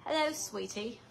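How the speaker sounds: mock TV host greeting, light and polished; TV presenter voice. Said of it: "I'm trying to talk like the people on T V".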